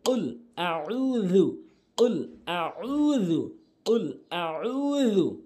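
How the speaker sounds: very slow, drawn-out উল আউযু; slow practice drill. Shown as a demonstration. Teacher drills উল আউযু slowly for practice. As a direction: stretch each syllable of উল আউযু slowly. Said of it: "repeated slow drill".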